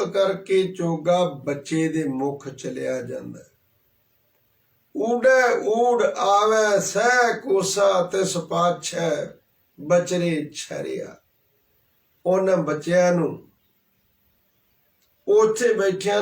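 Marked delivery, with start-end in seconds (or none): 5.0-11.2 scripture recitation. Acted. Preacher quotes scripture verse.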